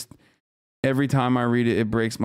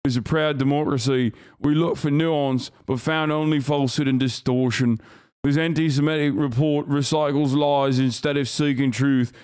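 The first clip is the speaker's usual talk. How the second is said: mock posh British accent reading a statement. Streamer mocking the UK statement in a British accent. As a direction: read it like a smug British spokesman. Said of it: "British accent".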